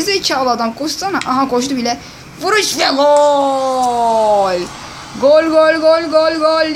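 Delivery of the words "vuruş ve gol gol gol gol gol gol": slow drawn-out goal shout; screaming football commentator. Pretend. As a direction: bellow the goal call.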